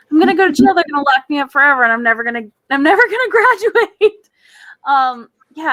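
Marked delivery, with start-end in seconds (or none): 0.1-0.7 higher, louder hammed-up panic on jail; mock panic. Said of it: "And I'm like". 0.7-4.3 fast, rising mock panic breaking into laughter; hammed-up doom, fast, laughing at the end; mock panicked rush. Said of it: "And I'm like".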